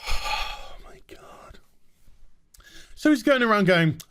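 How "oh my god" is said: unvoiced sighing whisper; exasperated whisper. Real, not acted. Ranter despairing over the doctor, sighing whisper. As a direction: breathe out in despair. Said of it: "exasperated sigh".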